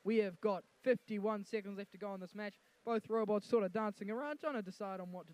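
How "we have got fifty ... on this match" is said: normal talking voice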